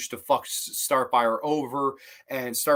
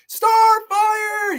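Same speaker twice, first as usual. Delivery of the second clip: high, loud desperate call; shouting a name; 8 dB louder than usual. Retold or imitated. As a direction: yell her name across the room. Robin calls out at the altar.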